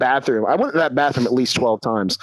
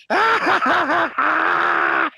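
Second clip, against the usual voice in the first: mocking laugh; 12 semitones higher, much slower than usual. Mock laugh in the retold scene.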